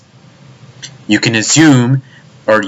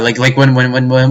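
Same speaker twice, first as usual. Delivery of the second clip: ordinary delivery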